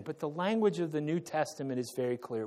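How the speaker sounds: normal talking voice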